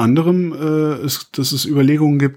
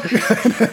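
laugh; 10 semitones higher than usual. Real, not acted. Laugh mid explanation.

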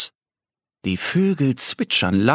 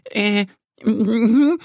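much higher, hesitant squirrel stammer; stuttering squirrel; 10 semitones higher than usual. A narrator's reading. The caught squirrel stammers at the birds, high stutter. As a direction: caught out, fumble for words. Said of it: "stottert das Eichhörnchen".

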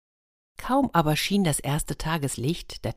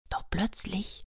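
suspense whisper; 4 semitones higher, 10 dB quieter than usual. A narrator's reading. Suspense turn in fairy tale.

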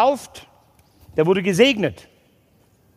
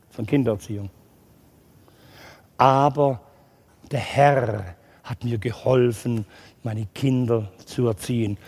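lower, soft assurance; sincere low appeal; 9 semitones lower, 3 dB quieter than usual. Real, not acted. Preacher assures parents the Lord helps, lower and soft. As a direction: comfort the congregation.

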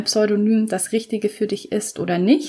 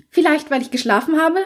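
hoch und spitz, schnippische Frage; schnippisch; 8 semitones higher than usual. Acted. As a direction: gib ihm frech kontra. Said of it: "schlug sie schnippisch vor". (Allison weist Ian spitz zurück, schnippisch)

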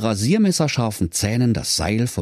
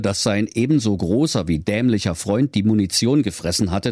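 plain reading voice

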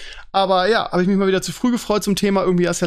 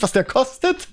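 higher, breaking into laughter; amused disbelief, tipping into a laugh; 7 semitones higher than usual. Real, not acted. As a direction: laugh through the words. Laughs about the car's price.

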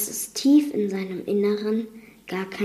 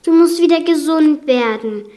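high, loud urging; distressed, insistent plea; holding back tears; 6 semitones higher, 7 dB louder than usual. A narrator's reading. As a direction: urge through suppressed tears. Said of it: "unterdrückte ihre Tränen".

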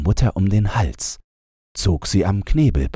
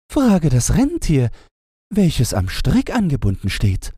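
higher, lively character voice; high storybook voices; 13 semitones higher than usual. A narrator's reading. Gretchen and the doves talk about the Snow Queen, higher voices. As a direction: ask and answer eagerly.

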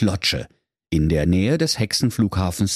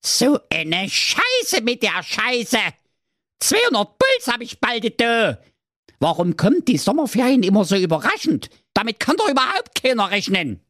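much higher, fake-sweet goat voice that turns into a fast rant; mock sweet mother goat; 14 semitones higher than usual. A narrator's reading. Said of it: "sagte mit lieblicher Stimme".

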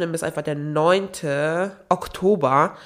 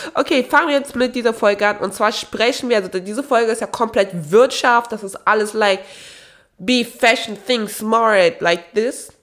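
higher, louder host energy; upbeat host; 5 semitones higher, 4 dB louder than usual. Real, not acted. Podcaster launching the new episode, higher and louder. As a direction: kick off the episode brightly.

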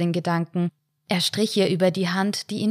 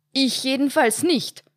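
protesting; 7 semitones higher than usual. A narrator's reading. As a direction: object hard, much higher than the narration. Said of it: "protestierte Heinz".

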